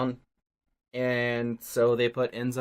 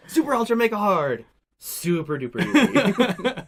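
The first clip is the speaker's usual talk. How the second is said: higher, louder mock hype; hammed-up exclamation; 9 semitones higher, 7 dB louder, slower than usual. Host joking about game difficulty modes, hammed-up exclamation. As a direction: blow the mode names up, high and loud.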